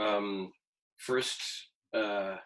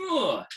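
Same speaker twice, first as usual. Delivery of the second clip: exasperated groan; 9 semitones higher, 3 dB louder than usual. Retold or imitated. Reacting to his wife's remark.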